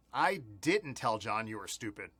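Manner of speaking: didn't leaned on, low-pitched denial; stress on didn't. Shown as a demonstration. Walking learners through the same sentence, stress on didn't. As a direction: put the weight on the word didn't. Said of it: "change the word that we emphasize".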